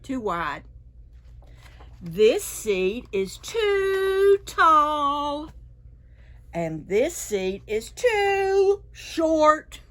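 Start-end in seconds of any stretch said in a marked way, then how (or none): 2.0-5.5 very slow, louder emphasis; drawn out. Shown as a demonstration. Teacher reading to kids, slow and emphatic. 6.5-9.8 very slow, louder emphasis; drawn out. Shown as a demonstration. Teacher reading to kids, slow and emphatic.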